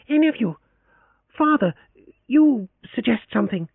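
put-on high, impassioned young woman; Leoncia, proud and pleading. A narrator's reading. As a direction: demand that her family act.

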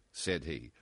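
plain reading voice

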